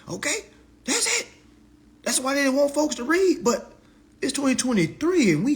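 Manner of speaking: loud, high, worked-up tirade; comic high-pitched rant. Acted. Reader admitting they were wrong to cuss Congress out, loud comic rant. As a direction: rant at full pitch, playing it up.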